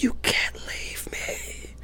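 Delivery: barely voiced, stretched-out plea; whispering what was once screamed at his wife; retold scream. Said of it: "I just screamed it".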